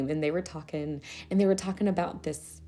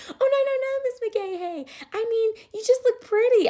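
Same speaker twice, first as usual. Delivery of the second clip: put-on child backpedalling; 3 dB louder than usual. Child backpedalling, voiced high.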